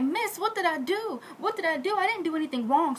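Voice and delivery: high, acted appeal; pleading to reason. Maid reasons with the lady beating her, high pleading. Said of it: "trying to reason with her".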